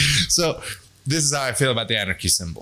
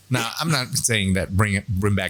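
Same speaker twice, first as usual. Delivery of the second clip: normal talking voice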